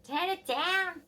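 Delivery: high, drawn-out mock whinge; high-pitched, stretched parental complaint; imitated complaining parent. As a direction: whinge it slowly as a nagging parent. Parent whinging about music, in story.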